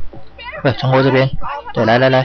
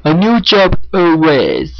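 drawn-out echo; 6 semitones higher, much slower than usual. Pretend. Echoes game line, stretched.

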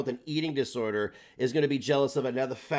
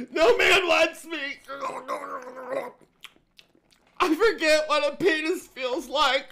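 mock crying whine; 5 dB louder, slower than usual. Mocking the streamer she reviews.

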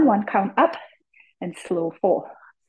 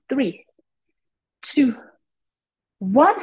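aerobics instructor; 7 semitones higher, 4 dB louder, much slower than usual. Real, not acted. Fitness instructor counting reps.

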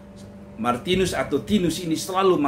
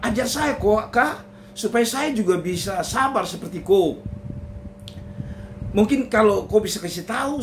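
higher, eager husband asking for the secret; pleading husband; 4 semitones higher than usual. Acted. Husband Tinus asking his wife for her secret, eager and higher. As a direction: beg her to teach you, pitched up.

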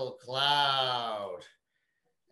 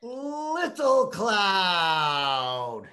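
slow loud call; 4 semitones higher, 4 dB louder, much slower than usual. Shown as a demonstration. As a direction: stretch out little cloud slowly and loudly. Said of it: "One more time".

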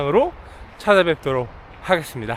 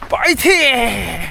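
cheerful shout; 5 semitones higher, much slower than usual. Real, not acted. Cheering himself on mid-run.

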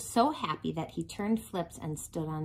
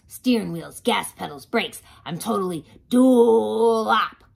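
loud, booming truck character; garbage truck voice; 8 dB louder, slower than usual. Acted. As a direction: boom it like a hungry truck. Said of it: "garbage truck voice".

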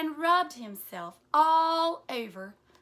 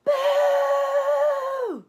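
high, very loud playful boo; loud shout; 14 semitones higher, 11 dB louder than usual. Acted. Elmer shouting boo to startle the herd, loud. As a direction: blast it out to startle. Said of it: "at the top of his voice, he shouted".

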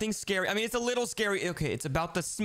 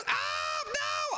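high alarmed yelp; alarmed cry. Acted. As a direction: cry out in fright. Brother grabbed by Poppy.

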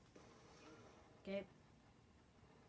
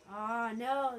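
loud, higher outburst; dismayed cry; 5 semitones higher, 13 dB louder than usual. Real, not acted. Player seeing rivals about to get it, dismayed cry. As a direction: cry out as it slips away.

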